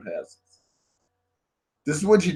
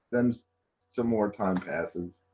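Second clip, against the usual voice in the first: usual speaking voice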